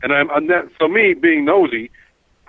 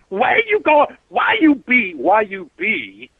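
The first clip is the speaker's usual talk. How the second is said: high, slow mock street voice for the woman passenger; streetwise woman; 4 semitones higher, slower than usual. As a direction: imitate her sudden street attitude, high and drawn out. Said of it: "she went street on me".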